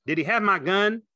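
high, loud questioning; Carlson, demanding. A narrator's reading. Carlson standing over George, loud demanding question.

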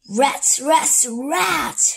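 villain cursing his failure; angry cry. Acted. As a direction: spit it out in defeat. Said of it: "cried Doctor Stinky".

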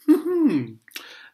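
sudden loud high cackle; loud burst of laughter. Real, not acted. Ranting man bursts into scornful high laugh.

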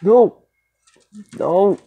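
high, sudden cries of no; real startled protest; alarmed refusal. Real, not acted. Fending off threat in horror game.

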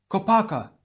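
high, urgent call; high-pitched alarmed cry. A narrator's reading. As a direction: call the name in warning. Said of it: "he cried".

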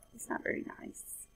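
soft unvoiced aside to listeners; whispered aside. A narrator's reading. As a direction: whisper the judgement to the kids. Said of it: "narrator's whispered aside".

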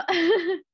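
short, high, emotional laugh. Real, not acted. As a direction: laugh with feeling. Host reacts after the reading.

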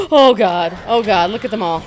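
loud, startled dismay; alarmed. Real, not acted. Gamer seeing a horde of dogs, alarmed. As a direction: cry out at sudden danger.

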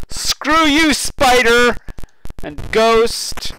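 high loud defiant yell; fear turning to anger. Real, not acted. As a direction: shout back at the monster. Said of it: "I'm not afraid of you!".

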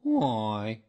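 loud, drawn-out mournful donkey voice; sad thought. A narrator's reading. Gloomy donkey puzzling to himself, sadly. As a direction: lament it aloud in a character voice. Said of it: "he thought sadly to himself".

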